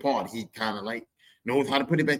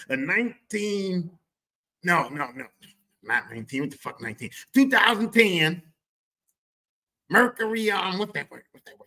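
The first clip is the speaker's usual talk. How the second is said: mock dad fumbling; 6 semitones higher, 4 dB louder than usual. Dad naming car model.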